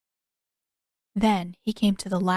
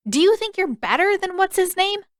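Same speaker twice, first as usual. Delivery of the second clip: put-on higher, louder sharp girl voice; girl shooting back; 11 semitones higher, 4 dB louder than usual. A narrator's reading. Girl retorting to Zuko, higher and louder. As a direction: fire the retort back at him.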